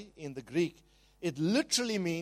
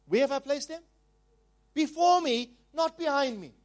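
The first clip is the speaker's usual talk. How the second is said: rising preacher's call; 9 semitones higher, 4 dB louder than usual. Real, not acted.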